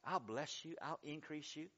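God calmly promising, hushed; gentle God. Acted. God reassures his people: soft, low promise. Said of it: "When God could just say".

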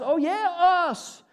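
loud, high burst of eager agreement; collective confidence. Acted. Believers hearing that the promise is for all of us, acted exuberant confidence. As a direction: whoop it like a cheering congregation. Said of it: "the tone in our voice changes".

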